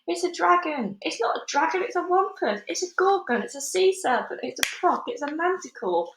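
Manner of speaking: high, frantic guesses tumbling out; each man yells a wilder monster; panicked shouting. A narrator's reading. Crowd of police shouting monster names in panic. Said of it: "all started shouting at once".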